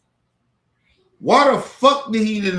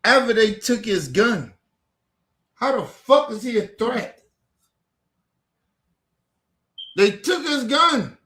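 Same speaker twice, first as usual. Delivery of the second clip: higher, heated disbelief; outraged; 8 semitones higher than usual. Real, not acted. Vlogger ranting to viewers about the shooting, high outraged disbelief. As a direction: raise the pitch in outrage.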